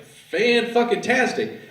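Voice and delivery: high, slow savoured Fan-fucking-tastic; drawn-out delight. Retold or imitated. Man enjoying the best blowjob, slow high delight. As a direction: stretch it out in ecstasy. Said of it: "And I'm like".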